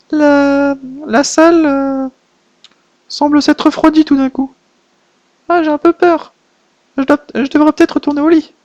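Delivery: put-on a touch of childlike fear; small girl, nervous and unsure; lightly voiced scared little girl. The little girl in a chilly room, mildly frightened voice.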